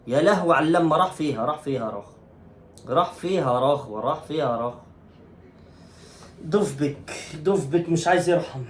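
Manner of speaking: ordinary talk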